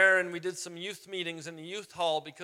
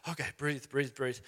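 nervous self-calming; 5 semitones lower, 6 dB quieter than usual. Retold or imitated.

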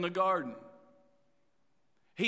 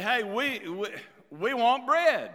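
loud, higher-pitched imitation of a complaining crowd; mock demanding; 6 semitones higher, 6 dB louder than usual. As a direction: demand it loudly, as the people in the retold story. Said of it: "was demanding".

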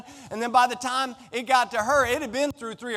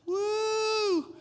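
high delighted woo; excited whoop; 12 semitones higher than usual. Real, not acted. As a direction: let out a big happy whoop. Recalling his amazing love letters.

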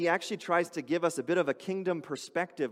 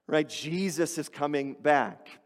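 ordinary delivery

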